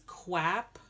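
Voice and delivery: "quap" with a kw start, not a kr; clear quap. Shown as a demonstration. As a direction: say "quap" crisply as kwap. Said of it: "not crap".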